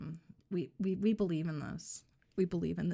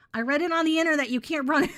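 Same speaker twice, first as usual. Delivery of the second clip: mock manic threat; 8 semitones higher, 9 dB louder than usual.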